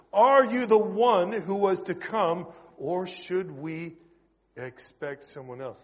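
slow, emphatic pulpit reading; scripture reading. Real, not acted. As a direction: read the verse out with weighty pauses, easing off at the end. Reads the Bible passage aloud.

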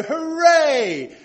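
high, loud demonstration cheer; long, exultant hooray; big cheer. Shown as a demonstration. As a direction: show the crowd how to cheer. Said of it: "I want you to go".

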